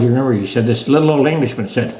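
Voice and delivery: usual speaking voice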